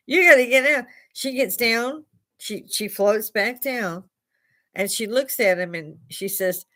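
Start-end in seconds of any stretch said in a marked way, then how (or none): 0.1-0.9 loud, high shouted order; yelling. Retold or imitated. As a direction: yell it as a warning.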